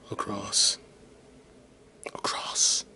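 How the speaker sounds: whispered while typing. Real, not acted. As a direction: breathe the word Across while typing. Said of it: "unvoiced whispered typing word".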